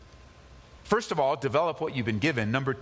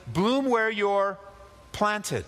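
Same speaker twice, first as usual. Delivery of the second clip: slow, lifted proverb; slow quoted phrase; 4 semitones higher, much slower than usual. Acted. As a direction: savour each word of the saying. Preacher quotes a popular phrase.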